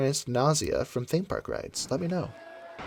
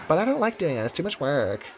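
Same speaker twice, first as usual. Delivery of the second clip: whiny voice; 3 semitones higher, 3 dB quieter than usual. Pretend. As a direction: whine it like a tired kid. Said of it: "It triggers my whiny voice".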